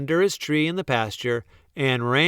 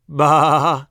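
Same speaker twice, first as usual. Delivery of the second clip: loud indignant sheep bleat; protesting baa; 3 semitones higher, 8 dB louder than usual. A narrator's reading. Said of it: "the sheep protested".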